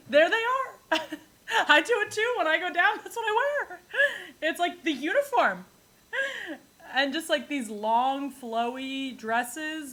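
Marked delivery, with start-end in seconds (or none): 0.1-5.6 high, laughing through the words. Real, not acted. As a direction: giggle while talking. Laughing at Florida clothes.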